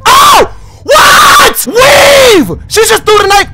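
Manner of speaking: sudden high yelp; startled shout. Real, not acted. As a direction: yell out in shock. Dodging a thrown knife.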